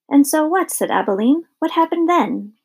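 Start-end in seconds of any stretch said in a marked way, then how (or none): 0.1-0.7 put-on higher, questioning child listener; eager interruption. A narrator's reading. Abilene interrupts her grandmother's story, eager and higher. 1.6-2.5 put-on higher, eager child listener; eager questioning. A narrator's reading. Abilene asks what happened next, eager and higher. Child begs for more story.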